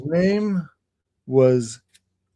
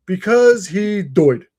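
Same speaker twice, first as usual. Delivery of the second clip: higher, slow, drawn-out English "doyed"; tour guide's English accent; 5 semitones higher, 4 dB louder, much slower than usual. Retold or imitated. Visitor mimics the tour guide's punchline, English accent. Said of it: "That's how his accent is".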